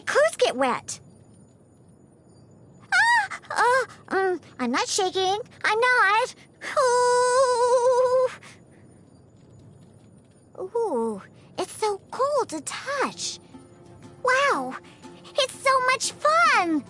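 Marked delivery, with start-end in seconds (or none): 2.9-8.3 high, loud wail while denying the shivers; shivering, whimpering. Acted. 14.2-16.8 high, excited squeal, drawn out at the end; delighted. Acted. Delighted by the snow.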